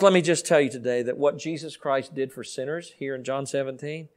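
ordinary talk

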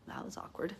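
barely voiced, whispered reaction; hushed aside. Daughter left in stunned silence, whispered aside. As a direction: say it under the breath.

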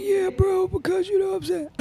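very high, squeaky voice; high-pitched mock baby squeak; Chris Sales impression. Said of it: "like, a high-pitched voice".

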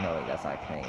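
normal talking voice

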